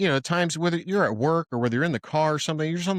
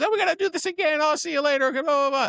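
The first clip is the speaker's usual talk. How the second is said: high, gushing farewell voice; gushing Italian goodbye. Retold or imitated. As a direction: gush your goodbyes way up high. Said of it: "the Italian goodbye is like".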